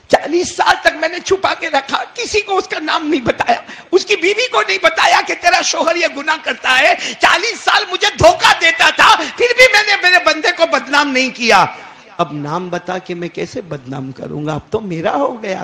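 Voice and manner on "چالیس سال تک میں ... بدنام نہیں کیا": high, loud preaching voice; fiery preaching. Acted. Preacher voices God to the congregation, fiery preaching. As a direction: preach it to the back row.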